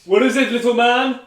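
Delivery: high coaxing pet talk; cooing at a pet. Acted. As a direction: coax the little cat. Calling to his pet.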